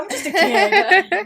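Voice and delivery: put-on high, loud, slow childlike whine; drawn-out kid voice, rising at the end; playful little kid voice. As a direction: play the small kid asking for slack. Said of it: "So now I'll go".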